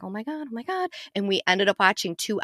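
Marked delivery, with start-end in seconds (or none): 0.0-0.9 hushed excitement. Retold or imitated. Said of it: "and I'm like".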